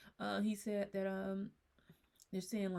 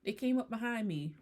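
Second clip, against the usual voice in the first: higher-pitched retelling of a victim's words; a hint of a TV show character; lightly voiced TV lady; 6 semitones higher, 4 dB louder than usual. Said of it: "and the lady be like".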